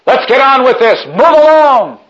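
high, loud barked order; soldier's shout. Acted. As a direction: yell at the crowd like a Roman centurion. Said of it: "soldier shouts out".

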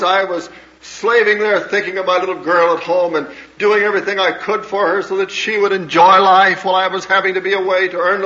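high and loud, hurt and defensive throughout; indignant protesting mother. Retold or imitated. Mother defending her sacrifices to her daughter, loud and hurt. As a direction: pour out every sacrifice, wounded.

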